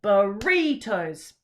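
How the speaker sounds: slow, loud mock-angry burritos, middle syllable punched. Shown as a demonstration. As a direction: pretend to be cross, lean on the middle. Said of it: "say it angrily".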